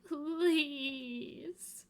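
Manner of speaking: begging. Acted. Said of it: "begged the next voice".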